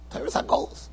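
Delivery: Torah's Hagolos breathed in a voiceless whisper; whispered echo. Shown as a demonstration. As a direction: echo it under your breath.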